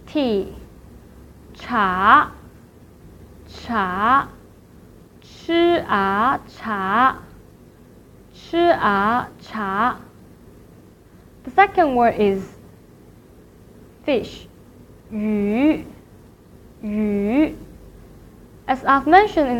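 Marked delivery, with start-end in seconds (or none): 1.5-10.0 "cha" starts low and sweeps up, sounded out as "chi a"; second tone. Shown as a demonstration. Teacher drills the word for tea, rising "cha". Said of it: "here are some vocabularies". 15.1-17.6 "yu" drawn out from low to a rise; second tone. Shown as a demonstration. Teacher drills the word for fish, rising "yu". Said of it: "here are some vocabularies".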